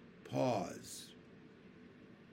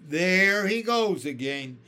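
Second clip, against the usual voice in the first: slow, louder earthworm exclaiming; exasperated cry; 4 semitones higher, 10 dB louder than usual. A narrator's reading. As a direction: cry out in fed-up disbelief. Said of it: "the earthworm cried".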